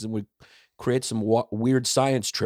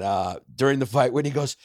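ordinary talk, nothing marked

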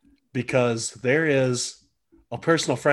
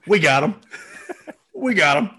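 George Bush impression; 5 semitones higher, 4 dB louder than usual. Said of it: "The George Bush".

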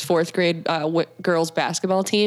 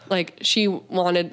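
ordinary delivery

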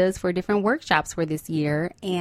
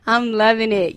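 laughing; 3 semitones higher, 3 dB louder than usual. Real, not acted. Laughing while saying it.

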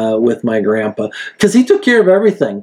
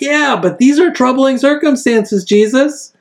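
anxious disciples; 10 semitones higher than usual. Retold or imitated. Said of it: "the disciples are like".

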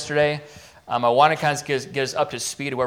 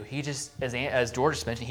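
normal talking voice

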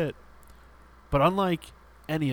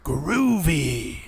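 drawn-out, loud game-villain taunt; villain's gleeful catchphrase; 6 dB louder than usual. Retold or imitated. Villain revving his chainsaw at the player, mock taunt. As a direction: stretch it out like a chainsaw-wielding showoff.

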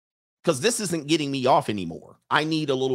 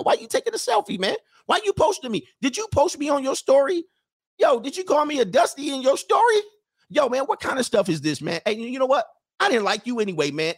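much higher, indignant rejected guy; offended guy; 11 semitones higher than usual. Acted. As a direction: play the wounded dude calling her out. Rejected guy confronts woman.

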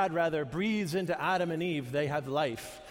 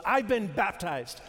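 declaring affirmation; 4 semitones higher than usual. Retold or imitated. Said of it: "saying them to themselves".

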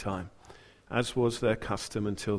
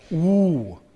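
spooky ooh; 6 semitones higher, 8 dB louder than usual. Pretend. Dramatic scene build-up.

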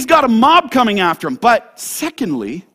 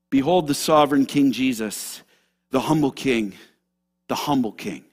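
low, soft, falling refrain; hushed reverence; 7 semitones lower, 5 dB quieter than usual. Real, not acted. Preacher inviting the congregation to behold the King, hushed reverence. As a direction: drop low and let it settle softly.

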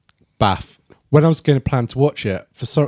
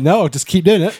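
mock villain defiance; 8 semitones higher, 5 dB louder than usual. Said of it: "and he's like".

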